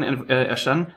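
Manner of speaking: normal talking voice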